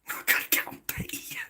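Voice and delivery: whispered through a laugh; whispered giggle. Real, not acted. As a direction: breathe it out while giggling.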